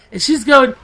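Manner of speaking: ordinary delivery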